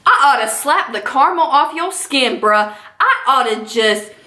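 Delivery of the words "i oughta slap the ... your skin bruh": ordinary delivery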